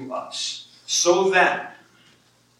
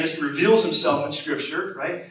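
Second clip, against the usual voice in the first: normal talking voice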